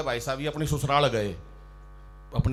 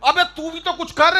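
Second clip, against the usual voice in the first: mock outburst; 10 semitones higher, 8 dB louder than usual. Comic retort at poet.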